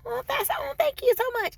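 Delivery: high squeaky gratitude; high, flustered thanks. Retold or imitated. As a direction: gush your thanks way up high. Surprised at customer's praise.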